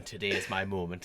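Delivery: mock grand hero. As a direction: play the big star stepping out. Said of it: "stepping out going".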